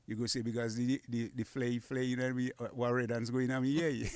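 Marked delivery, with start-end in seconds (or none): none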